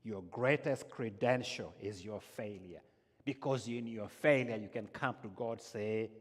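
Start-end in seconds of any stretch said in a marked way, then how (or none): none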